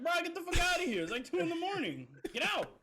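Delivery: high, loud retold shouting; exasperated yelling. Reenacting booting drunk friend at two a.m., exasperated yell. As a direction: act out kicking someone out, voice shooting way up.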